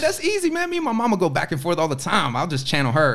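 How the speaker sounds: high-pitched, laughing boast; retelling his cocky answer through laughter; laughing brag. Retold or imitated. Retold confident reply, laughing.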